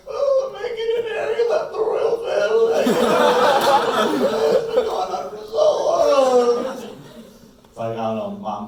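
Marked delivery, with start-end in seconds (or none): 0.1-6.7 very high, loud, slow giggling speech; laughing through words. Real, not acted. Comedian laughing too hard to finish the story. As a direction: squeeze the words out while cracking up.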